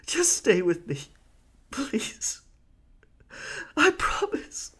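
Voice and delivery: high breaking whisper into tears. Acted. Pirate pleading as his friend dies, crying and breaking. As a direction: let the voice crack into crying.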